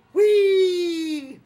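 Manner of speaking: high, loud playful squeal; a loud, high childlike whee; gleeful whee. Pretend. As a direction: squeal like a kid on a ride. Mock joy riding a rake.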